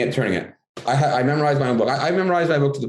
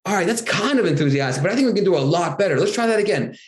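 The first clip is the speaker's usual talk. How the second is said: higher, upbeat push for more; coaching the class; 6 semitones higher than usual. Shown as a demonstration. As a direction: rev the kids up.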